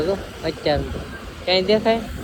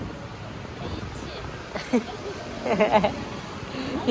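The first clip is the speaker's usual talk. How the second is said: breathy laugh; 3 semitones higher, 8 dB quieter than usual. Real, not acted. Laughs at a friend's joke.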